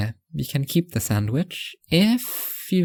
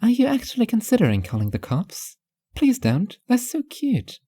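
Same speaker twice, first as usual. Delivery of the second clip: wife's voice, a little higher; gently raised voice of the wife pleading; lightly voiced wife; 9 semitones higher than usual. A narrator's reading. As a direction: play Mimi pleading, a touch higher. Mimi's texts voiced by narrator.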